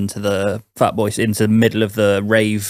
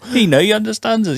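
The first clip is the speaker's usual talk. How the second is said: much higher voice; mock dopey teen; 11 semitones higher than usual. S1 imitating a starstruck fan, high voice. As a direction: gush like a dim fan.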